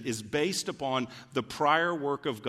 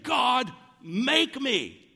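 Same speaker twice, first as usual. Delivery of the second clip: loud, high cry to God, each phrase drawn out; impassioned, insistent shouted plea; forceful demand; 10 semitones higher, 5 dB louder, much slower than usual. Acted. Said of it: "He's not being passive".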